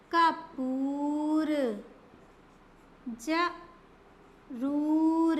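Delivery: each word very slow, long oo stretched; teacher reading a word list slowly. Shown as a demonstration. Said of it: "बड़े ऊ की मात्रा का प्रयोग".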